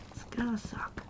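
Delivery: hushed whisper, voice dropped away. Shown as a demonstration. Vlogger whispers a gloomy joke to viewers, hushed whisper. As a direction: whisper the repeat as an aside. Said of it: "repeats it whispered".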